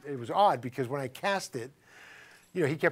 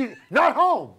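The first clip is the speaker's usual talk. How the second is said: high, loud, abrupt grandmother; curt mock yell into the phone; Greek grandma; 8 semitones higher, 8 dB louder, slower than usual. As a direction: imitate grandma shouting down a caller. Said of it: "grandma would say".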